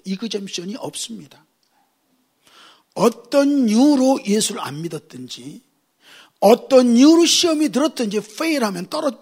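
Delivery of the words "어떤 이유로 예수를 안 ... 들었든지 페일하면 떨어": forceful preaching. Acted. Pastor warning congregation, loud emphatic preaching. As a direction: drive the point home.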